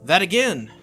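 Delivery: loud, abrupt irritation; acted annoyed outburst; exasperated retort. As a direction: throw it back, fed up. Saber's irritated retort in argument.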